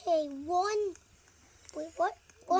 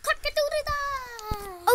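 rooster crow; 6 semitones higher, much slower than usual. Pretend. Imitating a rooster call.

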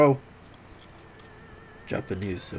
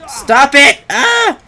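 high, very loud protest; frustrated yell; 12 semitones higher, 13 dB louder, much slower than usual. Real, not acted. Player yelling at an attacking foe, frustrated yell. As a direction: yell at the enemy to quit. Yells at game enemy.

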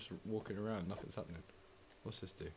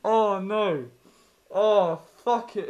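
loud, high groaning dismay; wailing over losing; 7 semitones higher, 9 dB louder, much slower than usual. Real, not acted. Player losing again, loud dismay.

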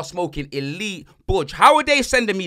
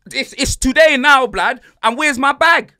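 indignant rant; 6 semitones higher, 9 dB louder, slower than usual. Real, not acted. Ranting about lost luggage.

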